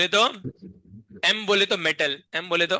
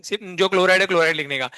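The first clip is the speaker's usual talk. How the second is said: normal talking voice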